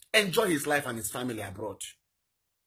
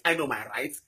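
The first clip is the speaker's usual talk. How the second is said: ordinary talk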